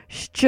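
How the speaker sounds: "sh" said as shch, soft and detached; isolated opening sound. Shown as a demonstration. Teacher has listeners build the word, "sh" said as shch. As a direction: isolate "sh" like the middle of fresh cheese. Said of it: "think of fresh cheese and take that middle part out".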